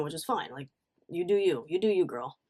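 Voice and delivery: usual speaking voice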